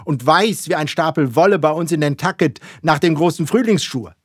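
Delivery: high, loud, rousing captain; excited captain's proclamation; fervent confirmation. A narrator's reading. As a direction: confirm each point with mounting fervour. Ahab excitedly confirming the crew's descriptions.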